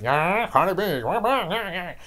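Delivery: high, loud, dopey mock voice. Host mocks [name] gushing over [name], high dopey voice. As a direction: ham up a dumb fan babbling.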